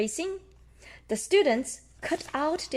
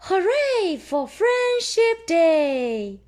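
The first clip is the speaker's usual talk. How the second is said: high, loud, slow cheer; high slow loud celebration; 9 semitones higher, 6 dB louder, much slower than usual. Acted. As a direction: cheer out the happy ending. Story's cheering ending.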